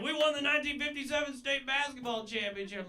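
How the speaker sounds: bragging grandpa. Retold or imitated. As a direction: puff up and brag like an old man. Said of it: "He's bragging like".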